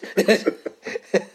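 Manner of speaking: bursting high laugh; real burst of laughter. Real, not acted. Laughing at Nandor dropping the bag, high laugh.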